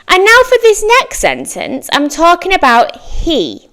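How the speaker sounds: usual speaking voice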